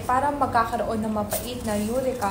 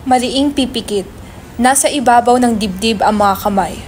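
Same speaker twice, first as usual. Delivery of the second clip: louder, even stage-direction read; 6 dB louder than usual. Acted. Narrator reading stage directions to the audience, even and loud.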